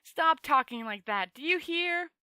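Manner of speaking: loud, slow, pleading rebuke; exasperated scolding. A narrator's reading. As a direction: tell him off, fed up. Rincewind scolds Two Flower.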